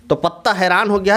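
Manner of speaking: usual speaking voice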